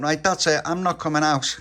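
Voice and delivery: loud, high-pitched outburst; acted childish huff; indignant refusal. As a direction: put your foot down loudly. Tony refuses to join game.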